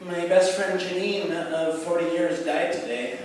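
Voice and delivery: put-on high, breezy old-lady voice; casual, unbothered grandma voice; grandma impression. Grandma casually brushing off her friend's death. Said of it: "she'll be like".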